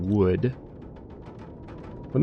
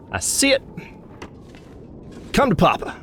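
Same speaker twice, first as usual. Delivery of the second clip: high, playful excitement; hammed-up high glee; gleeful discovery; 13 semitones higher than usual. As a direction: pitch it way up, coaxing the prize over. Spots the wanted bedroll.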